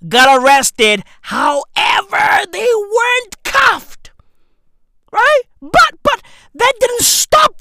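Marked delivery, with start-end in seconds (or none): 1.2-7.6 high, raised-voice exclamation; mock outrage. Comedian exposing the pretend handcuffing, mock outrage. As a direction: push the voice way up in disbelief.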